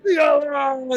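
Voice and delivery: high shouted name; triumphant announcement. Acted. Onthar announces the winner to the street, triumphant shout. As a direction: yell the winner's name to the crowd.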